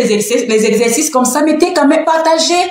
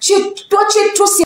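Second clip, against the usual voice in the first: imitated critics' complaint; 7 semitones higher than usual. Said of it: "il y en a qui disent que non".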